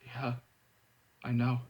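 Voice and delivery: soft, low, worn-out admission; all the fight gone out of him; admitting defeat, weary and quiet. Acted. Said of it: "suddenly weary".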